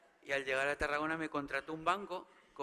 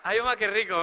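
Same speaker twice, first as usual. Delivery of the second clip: quoting the heckle; 5 semitones higher, 11 dB louder than usual. Retold or imitated. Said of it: "me ha gritado".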